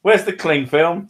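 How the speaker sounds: high, loud mock shout; acted call-out. Comedian voicing a character in a joke, shouted question.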